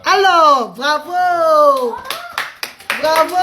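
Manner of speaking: high, loud cheering bravo; excited praise. Real, not acted. Mother cheering her son for saying hello. Praising child after he speaks.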